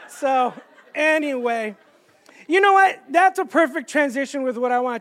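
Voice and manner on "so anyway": ordinary talk, nothing marked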